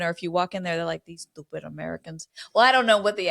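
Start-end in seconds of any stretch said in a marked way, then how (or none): 1.0-2.3 quieter, lower, badly faked Greek accent; a poor stab at a Greek accent; attempted Greek accent. Mimicking Greek restaurant staff scorning tourists, botched Greek accent. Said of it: "do not think that was a Grecian accent".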